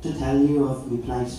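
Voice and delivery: lilting Irish ballad verse, climbing in pitch; rhyming Irish recitation. Acted. Son's text to his mother, lilting Irish verse. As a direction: recite it in a broad singsong brogue.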